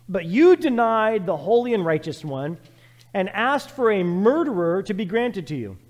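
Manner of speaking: loud, ringing scripture read; forceful preaching. Acted. Preacher thundering scripture at the congregation. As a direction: proclaim it to the whole church.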